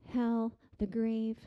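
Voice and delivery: ordinary delivery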